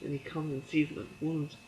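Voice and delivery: ordinary talk, nothing marked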